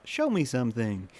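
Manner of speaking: loud, robotic mock American voice; robotic American accent. Shown as a demonstration. Sticking with the reel impression, loud robotic American accent. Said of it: "trying to do this robotic American accent".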